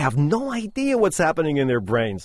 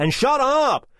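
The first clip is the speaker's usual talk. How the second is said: much higher mock-rude "And shut up"; rude boyfriend; 11 semitones higher, slower than usual. Boyfriend telling girlfriend to shut up, high mock-rude. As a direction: jump up high and spit it out. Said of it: "He says".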